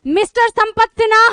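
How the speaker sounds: hammed-up announcer calling a wrong name; mock announcement. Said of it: "mic से announcement की".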